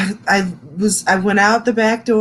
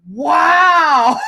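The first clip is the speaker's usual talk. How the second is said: high, loud drawn-out wow; mock stunned wow; 8 semitones higher, 5 dB louder than usual. The therapist reacting to her rant, stunned loud wow. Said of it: "She's like".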